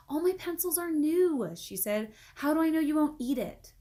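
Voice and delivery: put-on girl refusing at first; suspicious girl. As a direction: doubt him out loud. Rondi suspicious.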